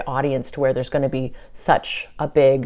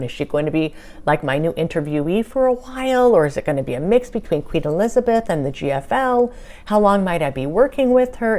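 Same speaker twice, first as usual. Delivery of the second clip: quick retold volley of questions, pitched up and louder at the start; firing off questions; 3 semitones higher, 3 dB louder than usual. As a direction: rattle off question after question, imitating your own eager interrogation. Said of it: "firing off all these questions".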